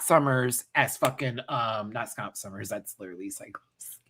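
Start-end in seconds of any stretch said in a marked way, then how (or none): 2.7-4.0 soft unvoiced whisper; hushed afterthought; whispered aside. Real, not acted. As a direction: whisper it to yourself.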